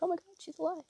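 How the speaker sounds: high-pitched mock gasp of relief; excited relief. Viewer reliving a heroine's survival, excited relief. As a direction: exclaim it high, reliving the thrill.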